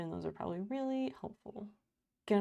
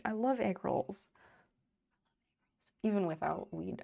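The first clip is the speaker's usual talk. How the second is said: normal talking voice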